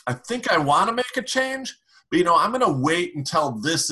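high-pitched imitation of a stalling customer; hesitant customer. Coach imitating customers who freeze, high hesitant voice. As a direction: play a customer putting you off, pitched well up. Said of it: "Maybe you've had customers say".

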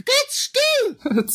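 high, slow squeaky helium voice. Acted. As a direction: panic cries on helium. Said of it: "wenn [name] so bescheuert spricht".

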